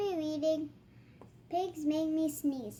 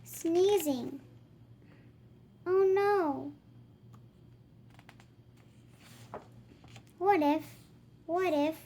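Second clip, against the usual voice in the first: put-on lively read-aloud voice for kids; reading to children. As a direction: act out the book for little ones. Reading picture book dialogue aloud.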